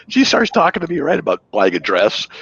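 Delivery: ordinary talk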